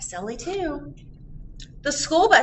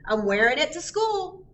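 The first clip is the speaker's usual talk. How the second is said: higher, eager mom voice; Mom showing off; 7 semitones higher than usual. Acted. Mom shows her kids her new backpack, higher and eager. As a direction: show off the new backpack like a proud kid. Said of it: "said Mom".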